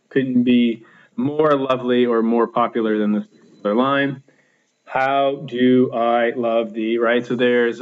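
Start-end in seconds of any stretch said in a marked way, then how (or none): 4.9-7.0 "How do I love thee" slowly scanned, unstressed then stressed; drawn-out iambic beat, leaning on "do" and "love". Shown as a demonstration. Teacher scanning a sonnet line, slow iambic stress on "How do I love thee". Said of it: "unstressed, stress the whole way through".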